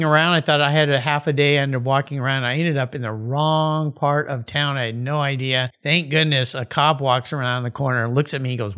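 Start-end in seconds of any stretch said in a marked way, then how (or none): none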